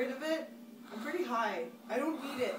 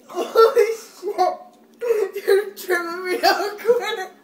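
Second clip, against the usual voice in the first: loud laughing, close to crying; hysterical laughing; 10 semitones higher, 18 dB louder, much slower than usual. Real, not acted. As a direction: laugh uncontrollably while high. Tripping on salvia, laughing hard.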